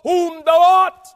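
loud, high frightened cry; demons crying out. Acted. Demons crying to Jesus, loud and afraid. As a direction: cry out the name in dread. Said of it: "he said".

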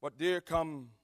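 usual speaking voice

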